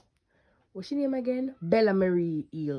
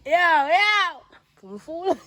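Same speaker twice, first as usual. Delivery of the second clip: loud, high hype; excited yelling; 9 semitones higher, 10 dB louder, much slower than usual. Real, not acted. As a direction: hype it up loudly. Excited reaction continues.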